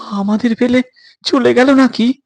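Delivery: a touch of fear, voice rising; boy scared they've been abandoned; frightened boy. Acted. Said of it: "সে ভয় পেয়েছে।".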